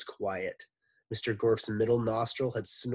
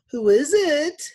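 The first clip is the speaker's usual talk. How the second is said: much higher, louder pleasant call; pleasant stolen voice; 14 dB louder than usual. Acted. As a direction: sweetly ask who's there. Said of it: "the pleasant voice he stole".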